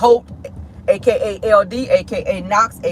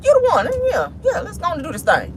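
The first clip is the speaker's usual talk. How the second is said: swooning; 9 semitones higher than usual. Retold or imitated. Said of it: "And you was just like".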